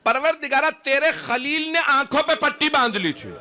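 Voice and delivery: very high, wailing appeal to God; impassioned high-pitched plea; loud lamenting cry. Retold or imitated. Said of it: "بلند آواز سے گریہ کر کے کہا".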